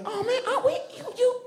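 very high, squealing astonishment; surprised high Southerner. Retold or imitated. Southerners learning he's African, surprised high voice. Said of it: "their voices go up really high".

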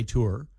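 "tour" as "TOO-er"; "tour" like "too-er", lifted at the end; own pronunciation. Shown as a demonstration. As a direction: say "tour" with an OO vowel. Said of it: "Which is what I say".

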